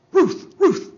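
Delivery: high, loud barked Ruth Ruth; dog bark. Acted. Dog barking the ballplayer's name, high and loud.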